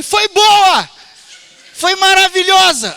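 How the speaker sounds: shouting without hearing himself, ears blocked; booming cheerfully, unaware how loud; loud deaf shout. Retold or imitated. Preacher retells answering the pastor at the airport, half-deaf, in a cheerful shout. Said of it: "[name], fala baixo".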